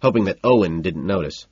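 ordinary delivery, nothing marked

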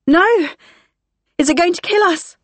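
high, frightened cry; terrified. Acted. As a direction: panic rising in the voice. Terrified question during alarm.

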